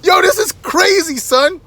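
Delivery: hammed-up hype for his video; filming attendant hyped. Attendant records for friends back home, high excited shout. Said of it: "He's like".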